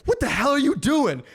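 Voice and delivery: high, loud furious yell; irate yell. Retold or imitated. Blood nurse catching coworker taking blood twice, furious yell. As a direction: yell at the new guy like you're livid. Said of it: "And she is irate".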